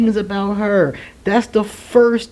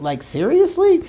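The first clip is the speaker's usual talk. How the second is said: incredulous; 9 semitones higher than usual. Real, not acted. Disbelief at the lover.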